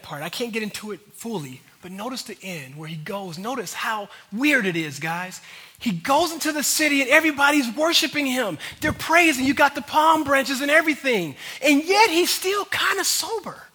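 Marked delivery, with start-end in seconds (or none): 5.8-13.7 raised, higher preacher's voice; fervent sermon crescendo; impassioned preaching. Real, not acted. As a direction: drive it home to the congregation, loud. Preaches at full force.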